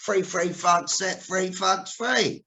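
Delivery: obliging Cockney retry, THREE just like FREE; Cockney th-fronting. Retold or imitated. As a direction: repeat THREE as "free" and THUGS as "fugs". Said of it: "I was going".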